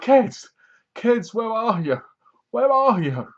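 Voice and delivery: high, searching call with stretched words. Retold or imitated. She looks for the hidden kids, calling out high. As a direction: call for missing children, acted.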